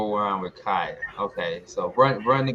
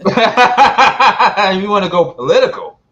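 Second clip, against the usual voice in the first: laughing; 11 semitones higher, 16 dB louder, slower than usual. Real, not acted. Laughing reaction to joke.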